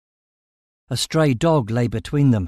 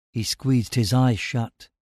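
plain reading voice

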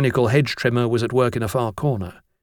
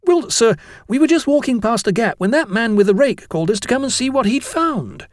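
put-on high-pitched boy explaining himself; eager schoolboy; 11 semitones higher than usual. Jennings explains himself to the master, high eager voice. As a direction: gush out your story to the teacher.

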